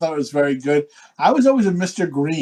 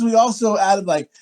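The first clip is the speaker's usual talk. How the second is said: ordinary talk, nothing marked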